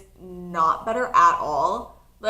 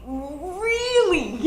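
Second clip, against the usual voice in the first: higher, laughing disbelief on Really; incredulous laughing really; 8 semitones higher than usual. Retold or imitated. Hearing foot was broken.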